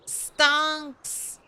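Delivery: silly drawn-out meme voice; meme stonks voice. Shown as a demonstration. Meme word said twice.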